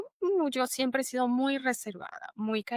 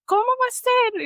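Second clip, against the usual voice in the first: disbelief; 13 semitones higher, 5 dB louder than usual. Retold or imitated. Acquaintance reacts in disbelief.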